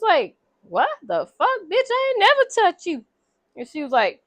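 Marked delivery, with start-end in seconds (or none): 0.6-3.0 high-pitched, incredulous retort; shocked denial. Retold or imitated. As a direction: retell his outraged denial, pitch leaping up. Retold shocked denial of an accusation.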